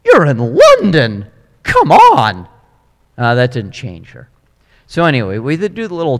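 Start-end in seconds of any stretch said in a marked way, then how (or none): 0.0-2.5 coaxing tour guide. Retold or imitated. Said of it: "He's like".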